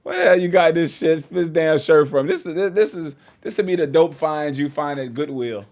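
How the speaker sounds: usual speaking voice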